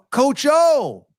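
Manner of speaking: loud, high call across a courtyard; excited shout of a name. Retold or imitated. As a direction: hail him from a distance, excited. Calling out to the coach.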